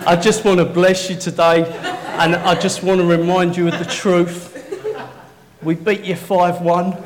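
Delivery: loud teasing gloat at rival fans; playful football banter; mock blessing. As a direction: rub it in with a grin, loud. Teasing Arsenal fans at church.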